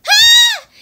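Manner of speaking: short, high, loud scream; play scream. Pretend. Startled by stupid bugs, high play scream. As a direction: pretend shriek at bugs.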